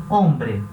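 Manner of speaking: hombre said as ombre; silent h. Shown as a demonstration. Teacher repeating the silent h. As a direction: drop the h in hombre. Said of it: "sempre vai ser mudo".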